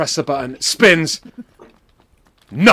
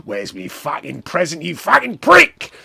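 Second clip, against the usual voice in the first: wrestler's angry demand; 5 dB louder than usual. Retold or imitated. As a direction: act out a Cockney wrestler's rage. Strangling wrestler demands his present.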